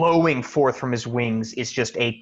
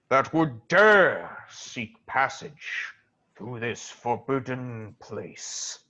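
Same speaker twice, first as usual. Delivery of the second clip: slow, booming sphinx voice; dark lord; slower than usual. Acted. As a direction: ancient guardian, slow and commanding. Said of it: "this massive sphinx says".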